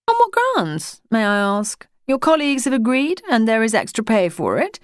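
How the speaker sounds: put-on a posh English official addressing a postman, in a high public schoolboy accent; high-pitched public schoolboy accent. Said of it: "in his high-pitched public schoolboyish accent".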